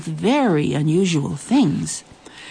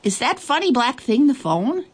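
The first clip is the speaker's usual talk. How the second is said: curious creature voice; 7 semitones higher than usual. Acted. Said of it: "asked the Nobble".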